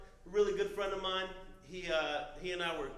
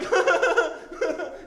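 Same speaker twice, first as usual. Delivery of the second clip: loud high laugh; 10 dB louder than usual. Real, not acted. Laughing at his fall.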